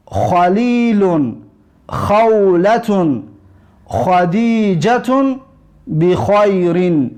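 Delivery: خلیلون, خولتون, خدیجتون, بخیرین read slowly, each خ rasped; slow drill words. Shown as a demonstration. Teacher dictating drill words for pupils to repeat, slow. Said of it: "تلفظ حرف خ را چقدر با دقت باشید".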